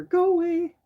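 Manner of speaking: sudden high squeal; startled squeal. Real, not acted. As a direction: shoo your own image away with a squeal. Squeals at her own screen image.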